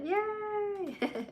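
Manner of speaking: very high squealed yay; thrilled high-pitched yay for the viewers; excited squeal. Real, not acted. Cheering viewers for the goal.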